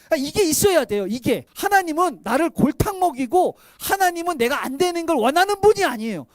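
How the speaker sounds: loud, driving preacher voice; fervent pulpit delivery; preaching at full force. Acted. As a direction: preach it out to the congregation.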